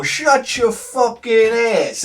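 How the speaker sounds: high, slow tough-guy line; drawn-out mock snarl; kid mouthing off. Anthony combing his hair, slow high mock snarl. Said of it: "we hear him go".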